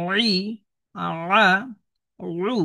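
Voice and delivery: রি, রা, রু pushed out from the middle of the throat; syllables রি রা রু voiced with light throat pressure; pressed from mid-throat. Shown as a demonstration. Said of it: "কণ্ঠনালীর মাঝামাঝি জায়গা থেকে একটু চাপ দিয়ে".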